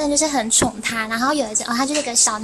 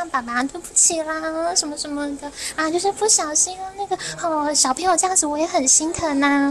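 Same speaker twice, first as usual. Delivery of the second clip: higher-pitched imitation of placating apologies; apologetic soothing; 4 semitones higher than usual. Boss soothing an angry parent, imitated apologetic coaxing. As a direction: play the boss smoothing things over. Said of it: "一直跟他道歉啊".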